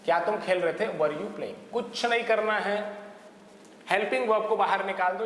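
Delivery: usual speaking voice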